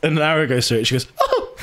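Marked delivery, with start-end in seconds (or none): 1.2-1.6 high, startled "oh" imitating a girl; mock horrified gasp. Girl sees the bunny get shot, high mock gasp. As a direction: squeak out a mock shocked gasp. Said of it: "and covers her eyes".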